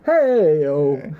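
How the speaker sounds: a long, drawn-out stadium call; mock arena singalong call. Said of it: "I was doing Live Aid".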